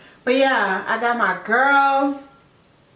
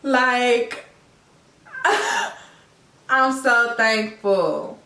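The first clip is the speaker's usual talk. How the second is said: real tearful voice, higher, breaking into laughs and sighs; crying happy tears; 4 semitones higher than usual. Real, not acted. Mother speaking of her baby daughter, crying with joy. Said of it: "I am literally in tears right now".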